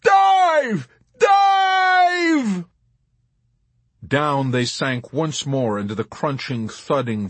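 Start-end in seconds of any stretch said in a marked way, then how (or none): 0.0-2.7 high, long-held scream; screamed command. A narrator's reading. Yossarian to McWatt in the barrage, screamed command. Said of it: "to McWatt he screamed".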